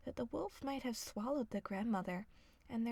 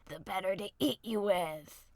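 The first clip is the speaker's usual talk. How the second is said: lower, louder wolf threat; menacing wolf; 4 semitones lower, 7 dB louder than usual. A narrator's reading. Wolf about to eat the girl, lower and louder. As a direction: snarl it like a wolf.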